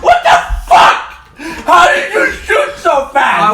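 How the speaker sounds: high, slow disbelieving shout; shocked yell. Retold or imitated. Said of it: "and I'm like".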